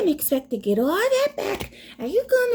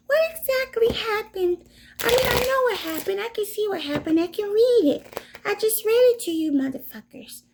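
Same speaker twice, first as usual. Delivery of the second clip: higher, put-on reading voice; 4 semitones higher than usual. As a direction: switch into a higher character voice. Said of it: "I like to change my voice".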